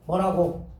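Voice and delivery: usual speaking voice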